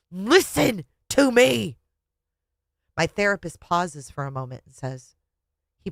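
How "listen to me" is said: slow, high, exasperated cry; exasperated scream. A narrator's reading. Wife with fists tight at her husband, exasperated scream. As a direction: stretch each word out in exasperation. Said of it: "in absolute exasperation".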